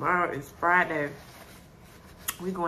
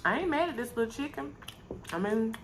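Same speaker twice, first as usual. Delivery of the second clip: speaking around food; chewing while talking; 4 semitones higher, 5 dB quieter than usual. Real, not acted. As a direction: mouth partly full. Seen 0: Bite taken at -3.0; pursed, working mouth while speaking till ~3.6.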